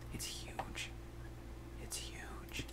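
very soft, voiceless whisper; awed whisper. Pretend. Gamer staring at a huge lava ocean, hushed whisper.

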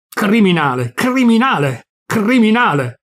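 building furious shout. A narrator's reading. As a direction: shout it so the garden hears. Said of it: "Alzò ancora la voce".

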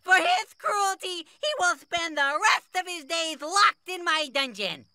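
high, indignant royal outburst; outraged king. Acted. King condemning the man who took the poor man's lamb, outraged. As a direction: rail at the culprit in a high, worked-up voice.

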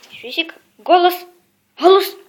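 loud bark command; talking to a dog. Real, not acted. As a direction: call out firmly to the dog.

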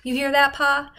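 head tilted back, calling up; defiant call into the dark. Acted. Boy calls out into darkness.

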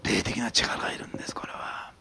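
sudden soft whisper. Acted. Pastor confiding to the congregation, hushed whisper. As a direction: lean in and breathe it. Hushed aside after loud preaching.

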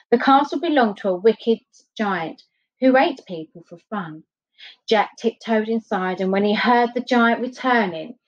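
ordinary delivery

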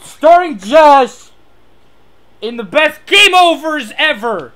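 loud, high, stretched-out promo delivery; mock announcer. Player announcing the show to viewers, mock announcer. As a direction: trumpet it like a series trailer.

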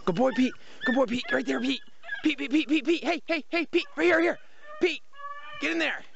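high, rapid-fire calls to a dog; urging the dog on. Real, not acted. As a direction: call out high and eager to your hound.

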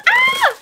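very high sharp squeal; high-pitched happy shriek; excited squeal. Real, not acted. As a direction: let out a piercing squeak of excitement. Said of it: "I'm so excited".

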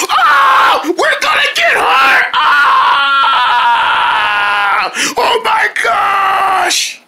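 high loud shouted scream; high panicked scream; crash panic. Acted. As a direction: shriek before impact. About to crash land.

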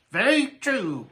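high-pitched raven croak; raven's croaky character voice. A narrator's reading. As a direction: croak it out like an old bird. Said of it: "croaked the raven".

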